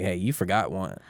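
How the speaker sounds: ordinary talk, nothing marked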